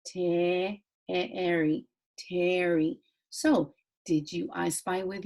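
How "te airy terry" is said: Terry in parts, te, airy, then blended; sounding out. Shown as a demonstration. Teacher reading a name to pupils, Terry sounded out in parts. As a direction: break Terry up, then join it. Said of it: "this is a name".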